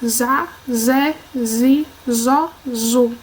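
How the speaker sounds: "za, zé, zi, zó, zu" one by one with a buzzing z; Z sound demonstrated. Shown as a demonstration. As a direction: sound out each syllable separately on a voiced z. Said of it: "tem o som Z". (som de Z, sílaba por sílaba)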